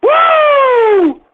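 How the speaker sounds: loud, high laughing whoop; laughing victory cry; triumphant whoop. Real, not acted.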